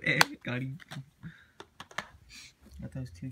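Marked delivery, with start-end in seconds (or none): 0.0-0.8 laughing at his own joke. Real, not acted. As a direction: chuckle through it. Laughing at own blue pun.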